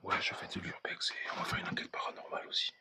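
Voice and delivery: hushed whisper, can't speak up. Real, not acted. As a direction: keep it to a whisper in the dark. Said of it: "Je peux pas parler plus fort".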